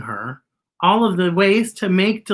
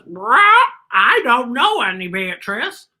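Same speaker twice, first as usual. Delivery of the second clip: higher-pitched crow voice with a caw; 6 semitones higher, 4 dB louder than usual. Acted. Crow answering the robot about Beatrice, high and cawing. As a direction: squawk it like a talking crow. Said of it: "And the crow goes".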